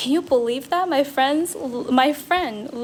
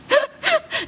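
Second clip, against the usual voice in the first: high squeal of laughter. Real, not acted. Streamer jokes she has a girl accent, then squeals with laughter.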